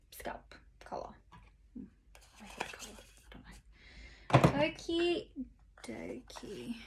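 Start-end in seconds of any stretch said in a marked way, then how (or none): 0.1-3.0 whispering. Real, not acted. Whispered aside.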